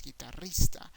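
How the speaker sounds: Spanish "guitarrista", gee-ta-RREES-ta; rolled double R. Shown as a demonstration. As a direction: roll the R in "guitarrista" firmly. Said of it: "You have that double R there".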